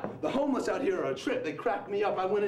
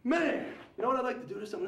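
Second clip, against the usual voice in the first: usual speaking voice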